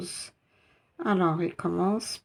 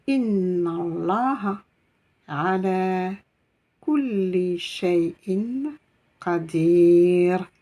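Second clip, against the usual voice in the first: lire lentement, mot à mot, avec un « qadir » plus fort; réciter posément pour les élèves; lecture lente à répéter; 3 dB louder, much slower than usual. Shown as a demonstration. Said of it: "relisons ensemble". (récitation lente et appliquée)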